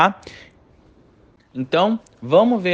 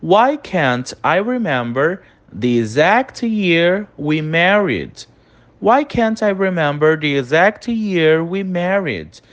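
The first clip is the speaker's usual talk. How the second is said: slow dictation; slower than usual. Shown as a demonstration. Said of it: "Próxima frase aí".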